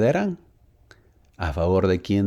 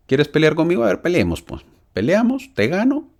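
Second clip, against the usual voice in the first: higher, louder taunting dare; a lightly acted bully daring someone to fight; mock challenge; 9 semitones higher, 5 dB louder than usual. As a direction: goad a weaker rival into a fight, half playful.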